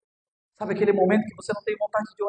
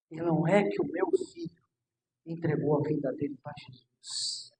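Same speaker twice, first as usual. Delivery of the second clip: much softer, low intimate sermon voice; soft preaching; 10 semitones lower, 8 dB quieter than usual. Acted. Pastor softly promising a mother her answered prayer. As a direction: lean in and speak softly to each listener.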